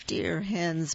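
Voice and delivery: usual speaking voice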